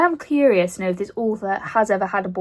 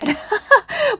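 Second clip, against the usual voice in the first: high laugh; 3 dB louder than usual. Real, not acted. Laughing at teary eyes.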